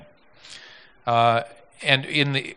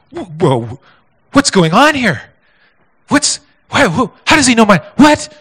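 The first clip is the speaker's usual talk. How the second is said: stunned disbelief; 9 semitones higher, 7 dB louder than usual. Retold or imitated. Ray stunned his name is known.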